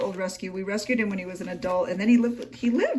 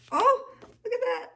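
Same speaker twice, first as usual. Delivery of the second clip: high, gushing coo over a cute dog; delighted squeal; 4 dB louder than usual. Real, not acted. Creator cooing at a dog picture in a gift journal, squealing high. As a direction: squeal like you just saw a puppy.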